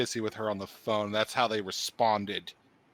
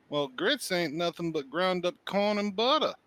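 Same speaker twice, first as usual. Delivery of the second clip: higher, slow Southern drawl on the grits; southern accent; 7 semitones higher, slower than usual. Shown as a demonstration. Shows off her Southern accent to friends. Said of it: "having spent entirely too much time in the South".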